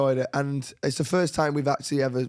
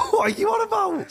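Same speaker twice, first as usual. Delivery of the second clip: high-pitched disbelieving outburst; incredulous. Real, not acted. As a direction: burst out in laughing disbelief. Incredulous reaction to misunderstanding.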